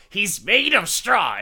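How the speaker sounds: high, loud mock exasperation; imitated furious outburst; losing it. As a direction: shout it like a wife at her limit. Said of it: "Louisa finally loses it".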